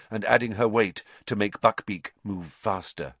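ordinary delivery, nothing marked

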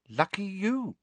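high, cool retort. A narrator's reading. Ginny responding to Harry's forgetting, coolly. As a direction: answer coolly, keeping the volume down. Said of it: "said Ginny coolly".

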